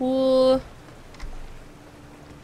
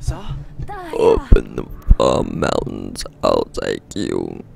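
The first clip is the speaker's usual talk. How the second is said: very low, slow giant voice; booming-low giant offering to guide the way; deep giant voice; slower than usual. Acted. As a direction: rumble it out like a gentle giant. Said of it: "I don't know why I did that voice".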